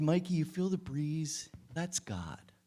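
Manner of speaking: soft, hushed, barely voiced at the end; hushed whisper. To Mikey on the stump, a hushed tender whisper. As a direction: breathe the words gently to a child beside you. Tender words to a dying boy.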